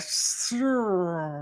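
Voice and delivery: "Sure" with a long, drawn-out R; failed rolled R. Shown as a demonstration. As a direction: drag out the R in "Sure", trying to roll it. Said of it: "I can't roll my R's".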